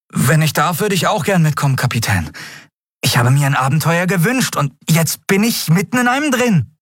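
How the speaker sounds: high, bright-eyed plea to come along; eager young Miko. Acted. Miko asking the captain to come along, high eager voice. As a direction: ask to join like a thrilled youngster.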